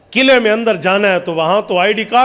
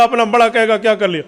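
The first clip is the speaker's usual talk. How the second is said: usual speaking voice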